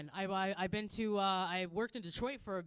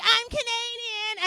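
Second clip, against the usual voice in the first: mock panic; 11 semitones higher, 12 dB louder, slower than usual. Panicked excuse.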